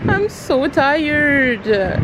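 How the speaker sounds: high, very slow, drawn-out moan; stretched-out, high-pitched weary complaint; exhausted whine. Real, not acted. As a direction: whine every word out long. Worn out after wrong bus.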